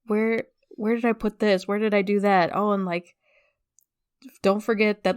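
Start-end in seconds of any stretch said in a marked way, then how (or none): none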